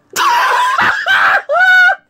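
very high, loud laughing shriek; shrieking laughter. Real, not acted. Cracking up at fart sound.

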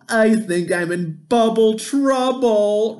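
high, loud, slow worried whimper; a pup wailing that he's in trouble. Acted. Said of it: "Rubble whimpered".

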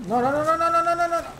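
high loud panicked cries. Real, not acted. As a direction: plead fast in panic. Panic as the character falls.

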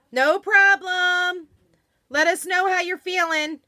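high, loud, drawn-out call. Real, not acted. Host calls to departing guest after vaccine shot, calling out. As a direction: call a friendly send-off across the house.

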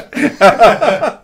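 loud, high laugh; high burst of laughter. Real, not acted. Laughs at friend's joke.

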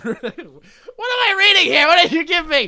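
high, loud incredulous outburst; hammed-up appalled shout; mock outrage. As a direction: protest loudly at what was handed over. Mock outrage at the news read.

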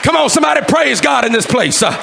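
loud, high shouted sermon in driving phrases; full-force preaching. Real, not acted. Preacher exhorting the church that today is their day, full-force shouted preaching. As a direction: preach it at the top of your voice.

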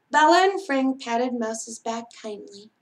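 ordinary delivery

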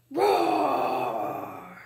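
big roar into the mic; full lion roar; loud roar. Shown as a demonstration. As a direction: roar as loud as you can. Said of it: "roar as loud as you can".

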